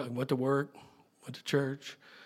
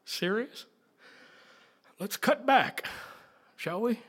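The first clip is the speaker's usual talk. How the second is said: higher, sly bargaining voice; mock haggling; 6 semitones higher than usual. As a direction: wheedle the offering down, tongue in cheek. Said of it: "And you're like".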